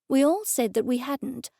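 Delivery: ordinary delivery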